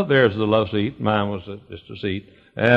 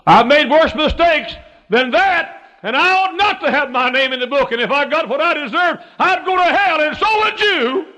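full-force preaching; 7 dB louder than usual. Real, not acted. Preacher confesses his mistakes to the congregation, full-force preaching. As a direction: preach it at the top of your voice.

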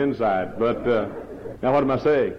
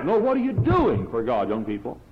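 ordinary delivery

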